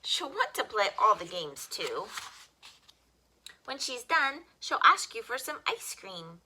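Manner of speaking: plain reading voice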